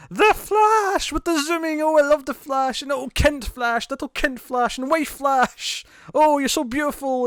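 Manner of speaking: high, drawn-out mock adoration; extreme gushing. Herr Wells showering the team with compliments, extreme gushing. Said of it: "he compliments them all extremely".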